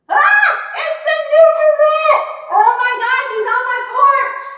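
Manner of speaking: real shriek, very high and loud; screaming. Real, not acted. Spotting a rat on the porch, screaming. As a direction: scream in startled alarm at the sight. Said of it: "I was screaming".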